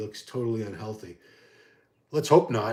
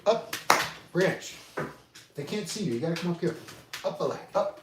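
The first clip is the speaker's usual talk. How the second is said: higher coaxing voice for a pet; coaxing the dog; 7 semitones higher than usual. Real, not acted. Owner coaxing his dog onto his lap for viewers, higher coaxing voice. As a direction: lift the pitch to lure a dog up.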